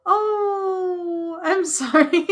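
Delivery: high, moved voice with a laugh; touched, half laughing at oneself; oddly emotional. Real, not acted. Reader apologising for getting emotional, moved. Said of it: "That got me, like, oddly emotional".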